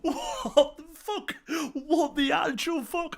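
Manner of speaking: high, slow, strained disbelief; holding back a shout. Real, not acted. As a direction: shout it but keep it down. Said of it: "I'm trying not to shout 'cause the baby's asleep".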